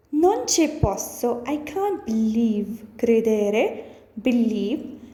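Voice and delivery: ordinary talk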